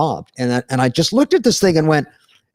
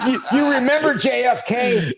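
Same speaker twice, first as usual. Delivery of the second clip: normal talking voice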